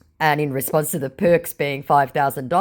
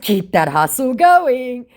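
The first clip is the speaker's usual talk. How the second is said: high, slow mock encouragement on Keep that hustle going; drawn out with fake cheer, stretching going; taunting a scammer in a singsong, high and slow; sarcastic cheer; 9 semitones higher, 6 dB louder, slower than usual. Her own sarcastic reply.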